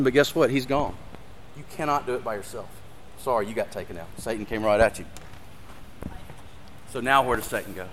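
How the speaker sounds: hushed, choked-up preacher, really moved; getting emotional. Real, not acted. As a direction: let the voice drop right down, holding back real emotion. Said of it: "Sorry, I'm getting emotional".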